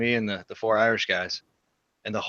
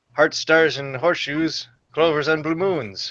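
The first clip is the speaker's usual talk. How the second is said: higher, louder jingle voice with a lilt; Lucky Charms guy; 6 semitones higher, 6 dB louder, slower than usual. Retold or imitated. [name] imitating the Lucky Charms jingle for Brian, leprechaun voice. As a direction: belt out the cereal jingle like the cartoon leprechaun. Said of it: "That's, uh, the Lucky Charms guy".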